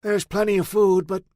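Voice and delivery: put-on high, loud old man's voice; old man Cletus. Cletus telling Pat she's foolish to stay, loud. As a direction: lecture her like a stubborn old farmer.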